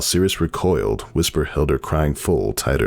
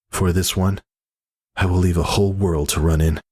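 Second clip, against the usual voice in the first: higher, heartfelt and earnest; sincere conviction; 7 semitones higher than usual. A narrator's reading. Whisper to her brother, heartfelt and sincere. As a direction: speak for the life she chose.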